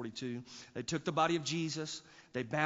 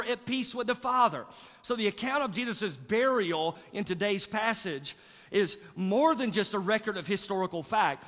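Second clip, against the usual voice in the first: full-force preaching, loud and high; 5 semitones higher, 7 dB louder than usual. Acted. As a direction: preach it with rising fervor.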